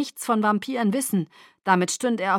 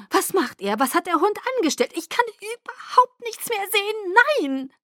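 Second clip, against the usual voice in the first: panische Fragen; 13 semitones higher than usual. Acted. Alice in Panik über Vision. (panisch, deutlich höher)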